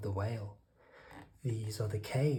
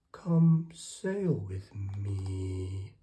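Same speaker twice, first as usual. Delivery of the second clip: slow, big whale voice, a little louder; 2 semitones lower, 4 dB louder, much slower than usual. A narrator's reading. Whale inviting the snail to sail with him, slow and big. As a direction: invite her slowly like a gentle giant. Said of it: "said to the snail".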